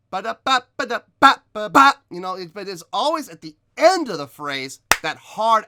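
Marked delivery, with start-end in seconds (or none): 0.1-1.9 fast, loud scat with a hit on a middle "ba" and the last "ba"; accented scat demo. Shown as a demonstration. Vocal coach showing accents in the middle of a phrase, fast and loud. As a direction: pop accents mid-phrase and on the final "ba", quick and much louder. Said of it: "sometimes there's accents in the middle as well".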